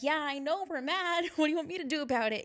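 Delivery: high-pitched mock exasperation; acted-out frustrated reply; exasperated retort. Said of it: "And I'm like".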